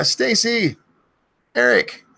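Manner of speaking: high yelled name; screamed name. Acted. Streamer yelling a viewer's name, high yell. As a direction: call it out as a big yell. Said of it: "have me scream your name".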